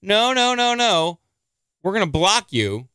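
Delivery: high, taunting singsong voice; a sneering, sing-song no-no-no; mocking the website. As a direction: mimic a smug website teasing him. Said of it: "now they're saying".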